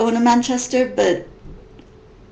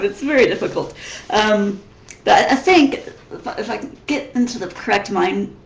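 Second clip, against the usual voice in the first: usual speaking voice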